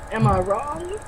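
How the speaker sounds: soft slow Walken question; lightly voiced Walken, drawn out; Christopher Walken. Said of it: "trying to do Christopher Walken".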